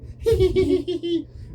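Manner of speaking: high, put-on cackle; hammed-up imitation of a grating laugh; mock annoying laugh. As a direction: imitate her irritating giggle. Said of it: "that little annoying laugh that she has".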